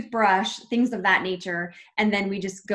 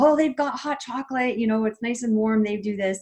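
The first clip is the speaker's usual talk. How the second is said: higher, louder imitated call to friends; telling the others; 6 semitones higher, 5 dB louder than usual. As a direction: pass on good news, acting it out. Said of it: "they'll go tell them all".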